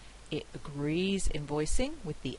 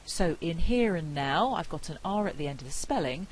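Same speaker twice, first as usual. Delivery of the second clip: ordinary talk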